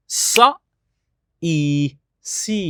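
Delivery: সা, ই, সি said slowly, one sound at a time, সা loud. Shown as a demonstration. Teaching her daughter to blend সা and ই into সি. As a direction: break সি into সা then ই. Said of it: "সিটা কিভাবে পড়বো".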